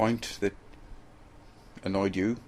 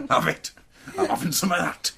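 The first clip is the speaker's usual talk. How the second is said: high, loud mock war cry; hammed battle cry; 9 semitones higher, 8 dB louder than usual. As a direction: yell it like a gleeful brute.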